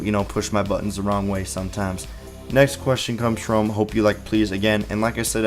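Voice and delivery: normal talking voice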